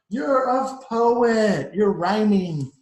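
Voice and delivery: loud, high and very slow delight; amazed praise. Acted. Mice marvelling that Frederick is a poet, loud and drawn out. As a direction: marvel at him.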